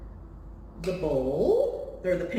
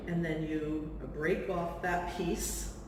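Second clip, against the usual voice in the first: ordinary talk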